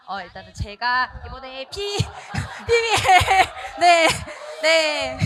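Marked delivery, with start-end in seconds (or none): 1.7-5.2 loud burst of real laughter; laughing. Real, not acted. Teacher cracks up mid-sentence while giving feedback, laughing. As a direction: break up laughing on the word, pitch jumping high.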